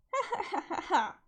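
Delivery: bursts into a high cackle; a short high laugh that falls away; high cackling laugh. Real, not acted. Leader laughs at his own mock order, high cackle.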